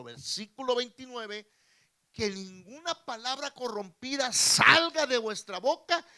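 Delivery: high, loud sermon proclamation; full-force preaching. Real, not acted. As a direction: thunder the verse at the congregation.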